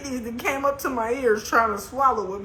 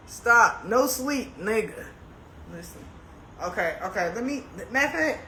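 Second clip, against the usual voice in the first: ordinary delivery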